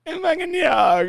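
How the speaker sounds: high mock sob; mock blubbering wife. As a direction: blubber the words like a sobbing wife. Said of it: "And she's like".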